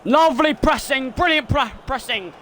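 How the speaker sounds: sudden excited shout; excited praise. Real, not acted. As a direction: call out praise as the play unfolds. Praising live play.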